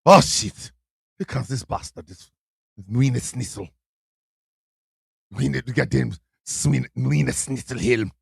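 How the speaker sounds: low, thick mock German accent. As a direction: ham up a heavy German barber accent. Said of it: "in a big, thick German accent".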